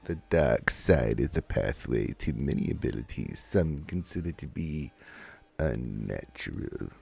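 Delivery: low, slow villain voice; ominous, drawn-out dark side whisperer; sinister dark lord. Acted. Recites a dark side film quote.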